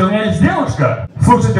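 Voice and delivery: normal talking voice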